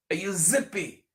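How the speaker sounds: normal talking voice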